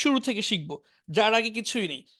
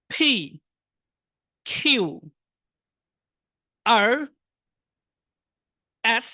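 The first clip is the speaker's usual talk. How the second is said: loud, slow letter-by-letter recitation; alphabet drill; 4 dB louder, much slower than usual. Shown as a demonstration. Teacher reciting letters loudly for students to repeat. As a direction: call out each letter for the class to repeat.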